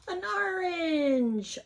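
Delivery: high, drawn-out "ooh, look" reveal; amazed discovery for a small child, slow and high; delighted surprise. Pretend. Delighted reveal under the flap.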